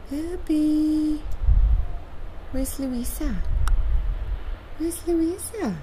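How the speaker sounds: loud, carrying call across the yard. Real, not acted. Owner calling loudly to her bird in the snow.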